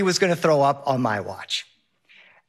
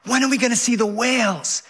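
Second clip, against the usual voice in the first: put-on kid yelling over wind; 6 semitones higher than usual. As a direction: call out to dad over the wind, excited. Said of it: "Henry yells".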